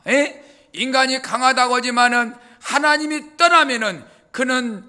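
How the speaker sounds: ordinary talk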